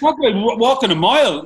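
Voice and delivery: high, loud astonished swearing; amazed outburst. Real, not acted. Friend amazed by his guest's pace, loud outburst. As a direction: blurt it out, blown away.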